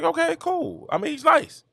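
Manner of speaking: put-on high, soft, shrugging kid voice; unimpressed kid. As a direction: brush it off like a kid. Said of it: "I was like".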